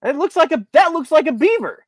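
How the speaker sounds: shocked at the picture; surprised. Real, not acted.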